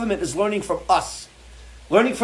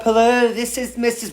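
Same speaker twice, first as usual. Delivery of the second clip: mock phone agent; 6 semitones higher than usual.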